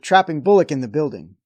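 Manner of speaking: ordinary talk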